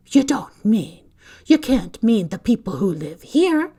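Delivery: appalled, refusing to believe it; cried out. A narrator's reading. McGonagall protests to Dumbledore about the Dursleys, loud cry. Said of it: "cried Professor McGonagall".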